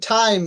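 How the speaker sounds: টাইম as "t-haim"; টাইম modelled firmly with aspirated T. Shown as a demonstration. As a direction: puff of air on the T of টাইম. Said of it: "ঠির উচ্চারণ কি".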